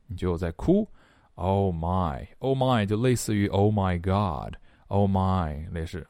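normal talking voice

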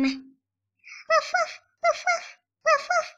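high yappy little woofs; high dog barks. Acted. Paul the dog barking back at Arthur, high yappy woofs. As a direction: bark like an eager puppy.

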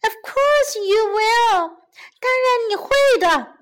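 high-pitched hopeful rabbit voice; pleading little rabbit. A narrator's reading. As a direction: insist in a small, high voice.

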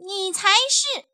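put-on high, loud childish comeback; retort. A narrator's reading. Little bunny pointing at the bully, hurling it back. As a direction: fling it back at him.

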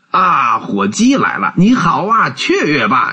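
high-pitched, delighted greeting; joyful exclaiming. A narrator's reading. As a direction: cry out in glee at a welcome arrival. Scrooge greets the arriving turkey.

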